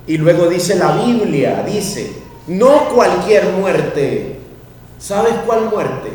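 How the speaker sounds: loud, high, slow proclaiming; full-force preaching. Acted. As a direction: thunder it at the congregation.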